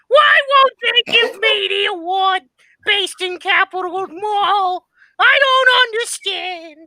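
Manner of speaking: high, slow, pleading whine to the mall; whiny Whitey prayer. Retold or imitated. Whitey begging the mall for his award, high pleading whine. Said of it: "he goes to pray to the mall".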